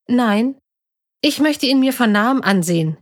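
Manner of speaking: mild, determined protest; contradicting. A narrator's reading. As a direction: push back and insist. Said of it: "widersprach Emily".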